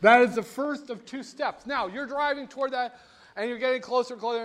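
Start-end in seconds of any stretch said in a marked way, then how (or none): none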